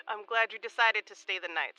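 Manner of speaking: normal talking voice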